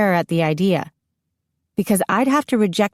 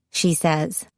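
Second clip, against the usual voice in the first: usual speaking voice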